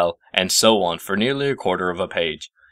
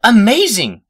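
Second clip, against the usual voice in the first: high, loud squeak; 8 dB louder, slower than usual. A narrator's reading. Twilight thrilled by the teleport, high loud squeak. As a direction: squeal with delight. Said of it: "squeaked Twilight".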